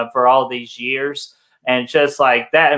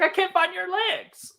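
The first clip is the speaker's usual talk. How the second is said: much higher, softer whiny put-on voice; mock Rambo breakdown; 6 dB quieter than usual. Friends parodying Rambo's speech to Troutman, whiny mock voice. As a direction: parody the soldier's meltdown, squeaky and small.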